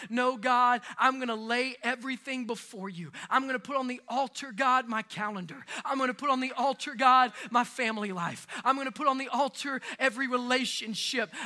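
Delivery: rising impassioned prayer, building; fervent preaching prayer. Acted. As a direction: cry it out to God, building.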